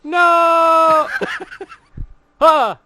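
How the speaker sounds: high, loud shriek of mock horror with a squeaky laugh; shrieking protest. Real, not acted. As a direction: yelp in outraged delight at the pun. Reacts to knife pun.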